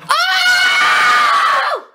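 high loud shout; excited shout. Real, not acted. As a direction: yell in surprise. Flip try, shout.

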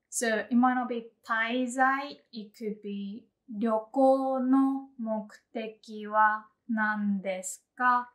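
usual speaking voice

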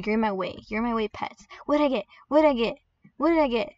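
normal talking voice

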